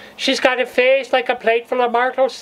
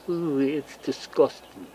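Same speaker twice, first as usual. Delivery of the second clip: disgusted ooh; 6 semitones lower, 7 dB quieter than usual. Acted. Recoiling at her own story.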